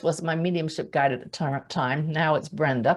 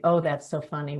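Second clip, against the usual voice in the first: ordinary talk, nothing marked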